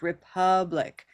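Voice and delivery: "Republic" slowly as "ri-PUH-blik"; correct stressed vowel. Shown as a demonstration. Teacher giving the real sound, slow "Republic" as ri-PUH-blik. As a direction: stretch the "uh" in the middle of "Republic". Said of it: "is the sound".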